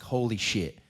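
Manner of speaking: ordinary talk, nothing marked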